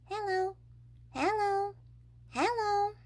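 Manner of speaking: high, rising parrot hellos; a parrot squawking greetings; high birdlike hello. A narrator's reading. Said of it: "exactly like a human voice".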